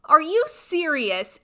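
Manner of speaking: high, loud disbelieving What; incredulous outburst. Retold or imitated. Blurting at the top coach in shocked disbelief. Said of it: "It flew out of my mouth".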